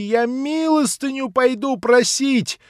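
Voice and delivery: high, loud, frantic shriek; frenzied cry. Acted. Nelly cries out in frenzy at everyone's concern, shrieking. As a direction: shriek it in a frenzy, slowing on не хочу. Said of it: "в каком-то иступлении".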